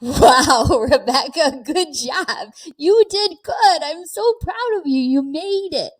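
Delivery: high, beaming self-praise with a laugh; proud older self. Retold or imitated. Imagining her older self praising her, high and beaming. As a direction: cheer yourself on like your hundred-year-old self. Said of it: "I wanna get to a hundred and say".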